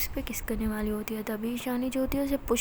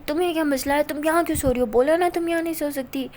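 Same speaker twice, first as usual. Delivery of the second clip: louder, higher annoyed scolding; irritated scolding; 3 semitones higher, 7 dB louder than usual. Acted. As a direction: tell her off sharply, fed up. Ishani scolds Pari in bed. (झुंझलाकर डांटती हुई ऊँची आवाज़)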